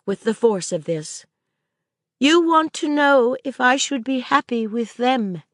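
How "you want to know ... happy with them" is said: put-on lightly higher girl's voice, earnest; a child asking anxiously; Maisie, young girl. A narrator's reading. Girl asking Sir Claude, lightly voiced child.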